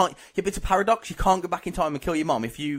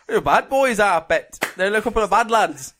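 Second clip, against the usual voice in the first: louder, higher mock Geordie; bad Geordie accent; 4 semitones higher, 5 dB louder than usual. Contestant finishing the challenge amid laughter, badly faked Geordie accent. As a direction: ham up a failing Newcastle accent. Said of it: "I'll go Geordie".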